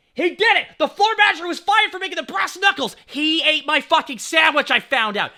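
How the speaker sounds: high, loud indignant yell; outraged shout. Retold or imitated. Outraged reveal of sandwich thief.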